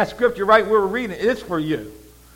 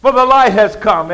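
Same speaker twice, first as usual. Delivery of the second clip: loud, forceful declaration; commanding proclamation; 9 dB louder than usual. Acted. Father declares over Jesus, loud proclamation. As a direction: proclaim it at full volume. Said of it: "So he said over him".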